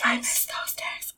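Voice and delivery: soft unvoiced whisper, mock nostalgic. Echoing her cohost's nostalgia in a mock whisper. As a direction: whisper back the same sad line.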